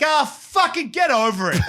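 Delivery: high, dismissive retort; mock drunk retort. Drunk husband brushing off his wife, high mock irritation. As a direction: brush her off, retelling it.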